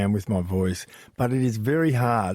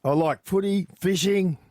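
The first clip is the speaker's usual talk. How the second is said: broad Australian bloke, pitched higher; super Aussie; 6 semitones higher, slower than usual. Shown as a demonstration. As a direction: give it the full Aussie bloke accent. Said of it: "there's a bit of that in there".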